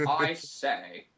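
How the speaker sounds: lightly hammed-up upper-crust gent; mock old gentleman. Podcaster answers friend's lecture, mock old gentleman. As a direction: play a fusty old chap for laughs.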